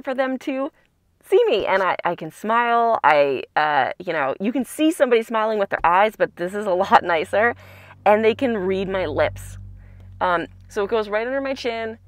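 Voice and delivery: speaking behind clear plastic; through a face mask. Real, not acted. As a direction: mouth covered by mask. Seen 4: Clear plastic mask worn over mouth throughout; removed near 22.7.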